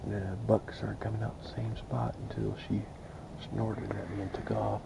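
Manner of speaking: hushed whisper in a hunting blind. Real, not acted. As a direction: whisper so the deer won't hear. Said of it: "I'm trying to whisper so I don't spook anything".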